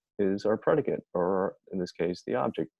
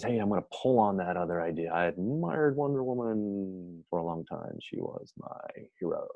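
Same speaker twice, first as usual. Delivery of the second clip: semicolon character. Acted. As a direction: play the semicolon pulling the words along, stretched out. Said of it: "Semi says".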